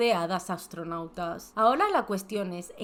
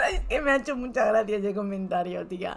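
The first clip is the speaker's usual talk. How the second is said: still laughing. Real, not acted. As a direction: giggle and explain.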